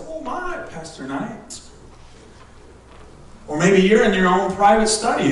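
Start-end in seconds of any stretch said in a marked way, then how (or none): 0.1-1.6 hushed oh my; quiet. Retold or imitated. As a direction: say it softly, heart pricked. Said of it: "They say".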